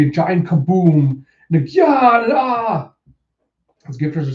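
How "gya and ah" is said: high, loud cartoon screams; mock scream. Gifters sent flying back, mock screams. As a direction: yell as if blown away.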